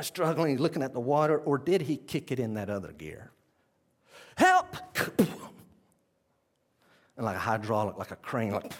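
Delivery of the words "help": sudden high loud yelp of help; desperate cry for help. Retold or imitated. Peter sinking in the waves, yelping help high and loud. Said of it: "and he cries".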